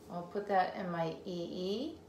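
normal talking voice

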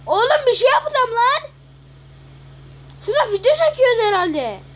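loud, high, frustrated yelling at teammates; exasperated shout. Real, not acted. Gamer yelling at his teammates, exasperated shout. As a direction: yell it in disbelief at your squad.

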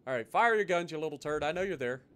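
usual speaking voice

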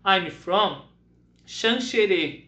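drawn out, clear hometown answer; teacher modelling a personal answer; slow model answer. Shown as a demonstration. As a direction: stretch the town name slowly. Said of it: "Eu posso responder".

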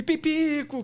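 high, loud, stretched-out nickname; playful mock sportscaster shout; mimicked commentator call. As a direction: copy the goal call to amuse a baby. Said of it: "Aí eu falei".

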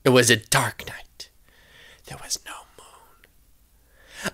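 spooky whispered narration; scared hush. A narrator's reading. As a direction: whisper it slowly to set a scary night. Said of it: "The Golly sisters are scared".

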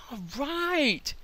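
a bright shout of triumph; excited cheer. Real, not acted. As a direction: celebrate it. Celebrating the working build.

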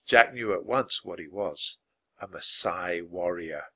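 usual speaking voice

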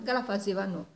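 ordinary delivery, nothing marked

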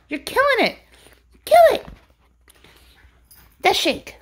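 high, loud hype for the dog; excited cheering. Real, not acted. Cheering dog shaking toy.

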